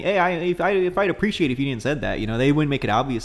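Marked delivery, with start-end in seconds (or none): 0.0-2.2 higher-pitched mock Canadian accent; polite Canadian. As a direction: object politely in a Canadian lilt. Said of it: "the Canadian would have told me".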